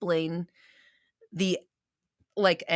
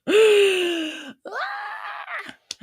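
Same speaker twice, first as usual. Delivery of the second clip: loud sharp gasp; 4 dB louder than usual. Real, not acted. Sudden reaction.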